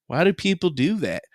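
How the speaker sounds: ordinary talk, nothing marked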